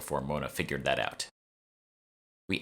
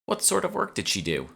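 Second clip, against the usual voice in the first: lightly voiced bright curator, much higher; curious curator. A narrator's reading. Curator Mona asking Emma, bright high curious voice. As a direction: ask eagerly as the curator.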